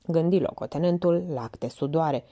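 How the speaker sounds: ordinary delivery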